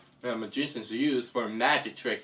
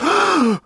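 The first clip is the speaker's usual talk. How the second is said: loud, high storybook gasp; shocked gasp; 14 semitones higher, 13 dB louder than usual. Acted. Caught bunny faces the hero, loud shocked gasp. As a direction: gasp loudly in surprise.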